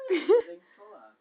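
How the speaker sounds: high, loud insistence; urging shout. Real, not acted. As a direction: push her to play it. Urging bandmate to solo.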